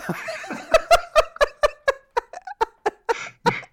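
shrieking burst of laughter; high squealing laugh. Real, not acted. Cohost cracking up at his own impression, squealing laugh.

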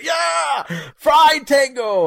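high, loud gleeful cheer; cheering villain shout. Retold or imitated. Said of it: "He's the biggest cheerleader".

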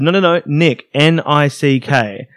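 exasperated customer spelling his name slowly for the cashier. Retold or imitated. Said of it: "And I said".

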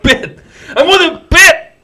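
high, loud shout on Pitt; hammed-up high-pitched yell; mock cheer. Co-host echoing the Pitt pick, mock cheering. Hammed-up echo of team name.

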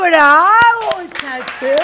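loud, high bravo; cheering. Real, not acted. Host cheers the guest's drill, loud and excited. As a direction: cheer him on loudly.

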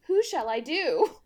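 a hint of fluttery mockery; mocking Victorian lady. Mocking Jessamyn's love dilemma, lightly fluttery. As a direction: mimic a dithering heroine. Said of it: "Oh, I cannot decide".

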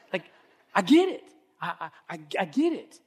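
high, loud stammered exclamation; squeaky, punched insistence; emphatic agreement. Acted. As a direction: burst out with comic exasperated sympathy. Comic emphatic sympathy with objector.

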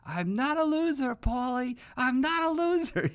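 high, loud screaming imitation of Adrian. Adrian screaming at her abusive brother Paulie, high mock shriek. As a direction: shriek it at him like Adrian finally snapping. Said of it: "she screams at him".

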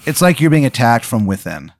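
ordinary talk

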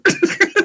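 short high burst of laughter; a real laugh at his own joke. Real, not acted. Laughing at the broken car handle.

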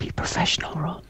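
hushed whisper, breathy. Real, not acted. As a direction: whisper so the caller can't hear. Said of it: "whispered aside on call".

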